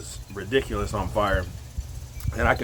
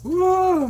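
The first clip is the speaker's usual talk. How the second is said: high whoop as the eyes water; pained whoop; 4 dB louder than usual. Real, not acted. Vlogger with watering eyes, high whoop. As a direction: blow off the burn with a whoop.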